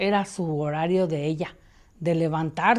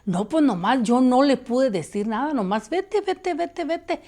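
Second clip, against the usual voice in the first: higher mock frightened vete; replaying the scared dismissal; re-enacted shooing; 7 semitones higher, 3 dB louder than usual. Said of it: "qué más le dijiste". (imitando el susto)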